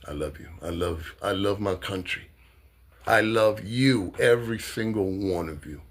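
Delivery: a hushed, heartfelt I love you; soft tender love. Real, not acted. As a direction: say it quietly to them with real affection. Soft affectionate aside.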